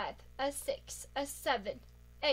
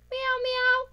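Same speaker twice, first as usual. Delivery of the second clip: cat meow; 7 semitones higher, 3 dB louder than usual. Acted. Voicing a pet cat.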